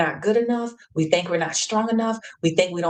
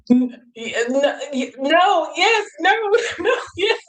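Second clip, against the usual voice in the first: panicked stammer; 9 semitones higher than usual. Retold or imitated. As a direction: stammer between yes and no, close to a cry. Panicking in the water, guide asks.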